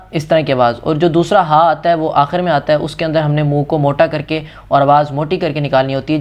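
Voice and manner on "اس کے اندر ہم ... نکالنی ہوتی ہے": ordinary talk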